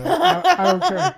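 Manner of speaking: very high, loud laughing squeal. Retold or imitated. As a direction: crack up as you say it. Firefighter quote dissolving into laughter.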